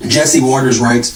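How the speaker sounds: normal talking voice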